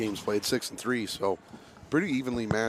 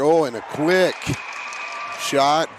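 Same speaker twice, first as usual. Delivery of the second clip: higher, louder sudden goal call; excited call; 5 semitones higher, 7 dB louder, slower than usual. Real, not acted. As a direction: jump on the sudden shot.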